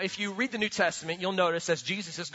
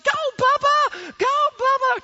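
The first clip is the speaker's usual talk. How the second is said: high, loud cheerleader chant; little girl cheering; 7 dB louder than usual. Retold or imitated. Daughter cheering her brother on in the backyard, high and loud. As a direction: squeal it like a tiny cheerleader.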